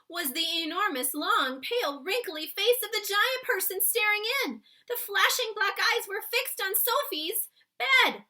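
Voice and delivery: dramatic read-aloud climax; building suspense. A narrator's reading. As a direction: read it like the scariest moment. Tense climax of read story.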